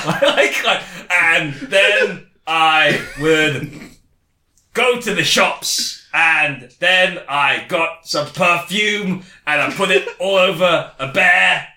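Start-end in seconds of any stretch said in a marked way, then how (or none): 1.1-11.7 slow, drawn-out mock recollection, pausing between phrases; halting recall. Mocking a friend's made-up life story, slow and halting. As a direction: mimic someone inventing memories as they go. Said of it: "is that how you remember things".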